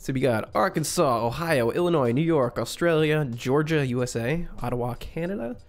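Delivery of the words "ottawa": ordinary delivery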